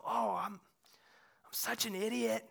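slow, drawn-out self-reproach; self-scolding. Retold or imitated. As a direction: groan it at yourself, slowly. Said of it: "I'll go".

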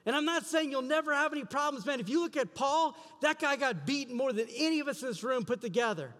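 high, loud impassioned preaching; fervent preacher building to a climax; preaching at full force. Acted. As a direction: drive it home with rising passion.

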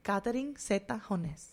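"Catherine Zeta Jones" with Spanish vowels, kah-teh-reen seh-ta ho-nes; full name said the Spanish way, a tapped r and an h in Jones; Spanish-style name. Shown as a demonstration.

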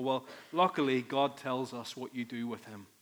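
ordinary talk

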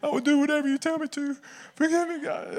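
very high, hammed-up begging; mock tearful pleading. Player volunteering laps to his coach, mock tearful. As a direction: squeal promises like a weeping teenager. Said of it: "I was volunteering for running laps".

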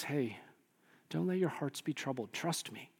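soft, hushed comfort; tender reassurance. Acted. Preacher as Jesus comforting those who were left, soft and tender. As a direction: gently ask them to trust you.

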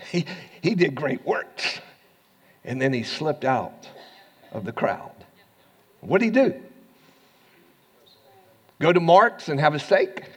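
normal talking voice

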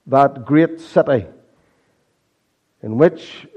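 impassioned sermon delivery; preaching. Acted. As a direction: preach it to the congregation. Preaching with rising force.

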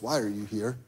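hushed, low inner voice of God; the Lord speaking. Retold or imitated. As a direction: drop to a near-hush as the Lord asking. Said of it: "and the Lord goes".

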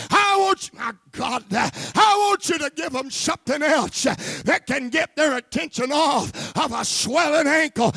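loud, high preaching shout; full-force preaching. Acted. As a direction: preach it out hard over the crowd.

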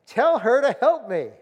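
irritated, resentful complaint building to a loud demand; exasperated. Acted. Martha complaining to Jesus about her sister, exasperated. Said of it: "can you hear the exasperation".

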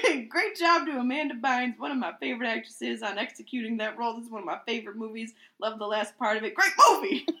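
gushing, building to a cheer; excited reading. Acted. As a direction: read it like an excited fan. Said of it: "read it as is".

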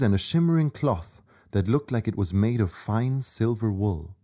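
ordinary delivery

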